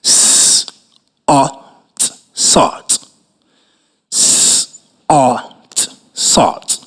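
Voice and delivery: soft unvoiced letters "S-O-T", then "sort"; whisper-spell "S-O-T", then say "sort". Shown as a demonstration. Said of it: "Our next word is".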